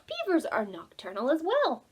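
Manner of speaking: ordinary delivery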